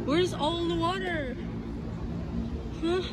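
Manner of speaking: ordinary delivery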